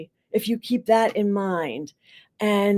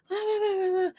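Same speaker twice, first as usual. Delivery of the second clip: high, soft wordless imitation of a woman; muffled female voice; 11 semitones higher, 7 dB quieter, faster than usual. Counselor overheard through the wall, imitated female murmur. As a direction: mimic a woman's indistinct voice heard from next door. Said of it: "you hear a female voice going like".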